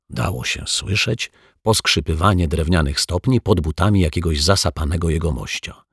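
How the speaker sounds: usual speaking voice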